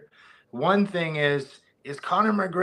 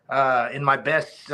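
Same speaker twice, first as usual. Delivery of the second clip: ordinary talk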